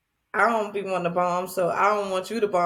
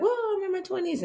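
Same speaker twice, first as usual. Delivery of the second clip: mock excuse; 11 semitones higher, 4 dB quieter, faster than usual. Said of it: "I could be like".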